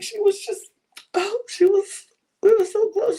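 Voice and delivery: high, hammed-up tearful voice; mock crying. Imitating Linda explaining her tears, mock crying. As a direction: play at choking up over a friend. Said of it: "just burst out crying".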